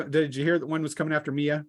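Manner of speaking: normal talking voice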